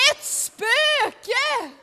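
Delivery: high, loud cry of "Ett spöke!"; frightened disciples shouting; shouted in fright. Acted. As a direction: scream it as if you see a ghost. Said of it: "Sen skrek de rakt ut".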